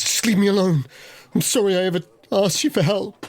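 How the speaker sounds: high-pitched, wounded outburst; high, distressed rebuke; upset woman. Acted. Caught woman lashes out, acted.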